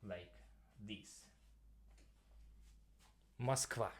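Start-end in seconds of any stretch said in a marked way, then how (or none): 3.4-3.9 Moskva said the Russian way, v sound at the end; Russian word Moskva. Shown as a demonstration. Teacher demonstrating the Russian capital name, Moskva said the Russian way. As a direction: Moskva with stress on the last syllable. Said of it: "So that's the thing, Moskva".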